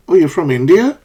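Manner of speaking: "Oh, you're from India?" swinging up on "India"; questioning rise. Shown as a demonstration. Someone not sure where the person is from, questioning rise. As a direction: check with interest, voice climbing on "India". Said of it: "They're not sure if this person".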